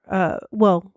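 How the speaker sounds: normal talking voice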